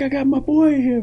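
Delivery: high, loud boastful dad; proud drunk dad. Retold or imitated. Dad showing off his son in the club, high and loud. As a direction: brag about your son. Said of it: "my dad's like".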